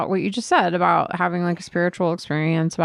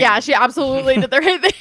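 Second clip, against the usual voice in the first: laughing sarcasm; 6 semitones higher, 6 dB louder, faster than usual. Acted. Joining the sarcastic bit, laughing.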